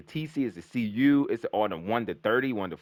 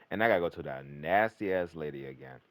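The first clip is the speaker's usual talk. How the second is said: ordinary talk, nothing marked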